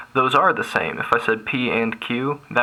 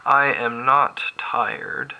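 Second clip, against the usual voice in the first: slow, each word drawn out; slow dictation; slower than usual. Shown as a demonstration. Teacher slowly dictating the statement to students while writing. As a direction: spell it out as you write it.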